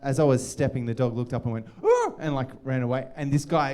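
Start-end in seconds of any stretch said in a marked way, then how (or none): 1.8-2.1 high-pitched startled dog noise; quick, very high mock yelp; imitated dog yelp.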